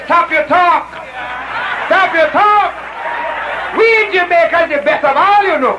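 imitated boastful Jamaican brother; Jamaican accent. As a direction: cut them off and brag in a mock Jamaican accent. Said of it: "the brother from Jamaica says".

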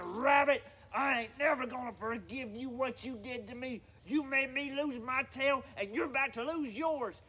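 angry. Acted. Bear holds Rabbit up by the ear, angry threat. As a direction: threaten revenge at full voice. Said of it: "And Bear got angry".

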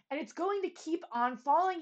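mild, high-pitched retort; a restrained storybook shout; shouted outburst. A narrator's reading. Bartholomew losing patience with the king, mildly shouted. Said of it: "he shouted".

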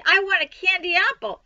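high, loud, eager outburst; excited child's demand. A narrator's reading. Child begging for a candy apple, excited. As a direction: voice a child clamoring for a treat.

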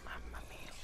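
breathy whisper of dismay; barely voiced, shaken relief; a whispered oh my god; whispered mamma mia. Real, not acted. Dismayed at near death.

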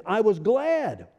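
"glad" drawn out long and pitched high; stress on GLAD. Real, not acted. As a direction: stretch out "glad" slowly with a high swell. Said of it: "We're emphasizing the word glad here".

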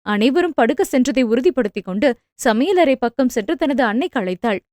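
plain reading voice

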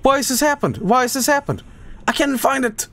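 high, urgent, helpless outcry; panicked frustration. Real, not acted. Gamer can't find the leak, panicked. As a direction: plead upward in a panic.

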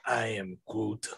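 hint of a sulky teenage Groot. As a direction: mumble it like a sulky teen tree. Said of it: "the teenage one".

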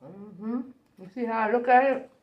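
speaking between bites; chewing while talking. Real, not acted. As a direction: mouth partly full. Seen 0: holds bitten burger, jaw working between words throughout stretch.

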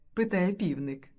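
usual speaking voice

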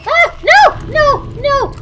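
high, loud desperate no's; panicked screaming. Real, not acted. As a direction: scream no as they swarm. Leeches attack her character.

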